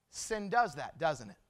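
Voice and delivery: low, hushed warning with long pauses; solemn preaching. Real, not acted. Preacher turns from story to sin, soft solemn warning. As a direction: let each phrase settle gravely.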